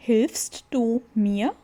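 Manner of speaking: Hilfst du mir drawn out slowly; slow it right down for the class; slow model. Shown as a demonstration. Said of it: "vamos completar aqui".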